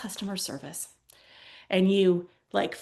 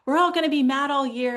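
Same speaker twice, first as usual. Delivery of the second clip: higher-pitched imitated student protest; mock indignant complaint; 7 semitones higher, 5 dB louder than usual.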